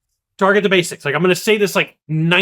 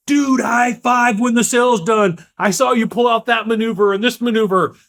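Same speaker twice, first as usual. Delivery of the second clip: excited cheer; 5 semitones higher than usual. Retold or imitated. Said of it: "looking over your shoulder and like".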